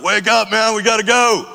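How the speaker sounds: loud, high, pushy call to get up; rowdy wake-up call. Retold or imitated. Bert hollering at the door at dawn, high and loud. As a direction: bang on the door and holler him awake.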